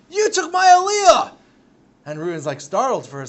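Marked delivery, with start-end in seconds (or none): 0.1-1.3 slow, very high, loud furious yell; furious shout. Acted. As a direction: scream the grievance in his face. Shimon spits, shouts at rescuer.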